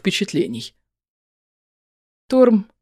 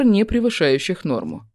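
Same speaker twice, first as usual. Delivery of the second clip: plain reading voice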